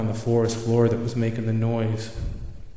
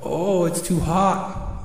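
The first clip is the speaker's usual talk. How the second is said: higher, slow owl voice, mildly put out; complaining owl; 6 semitones higher, slower than usual. Acted. Owl looking up at the first sun, complaining. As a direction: groan about it in a higher owl voice.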